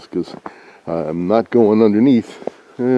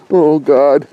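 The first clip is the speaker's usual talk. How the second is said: shivery cold reaction; reacting to cold; 5 semitones higher, 4 dB louder, slower than usual. Real, not acted. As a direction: gasp it out, freezing. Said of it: "That's cold".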